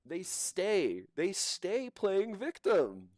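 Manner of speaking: sing-song scorn at playing victim; mocking taunt. Real, not acted. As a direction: drag out the words to mock her. Mocking her victim act.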